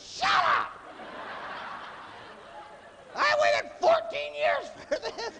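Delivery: shrieked falsetto mock fury; very high, hammed-up indignant yell; defiant inner voice. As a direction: screech back at your conscience. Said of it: "two voices that get in an argument".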